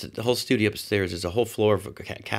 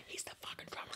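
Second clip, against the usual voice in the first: hushed whisper so Roger can't hear; 23 dB quieter, much faster than usual. Real, not acted. Whispering to his girlfriend about the drummer. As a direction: whisper it urgently aside.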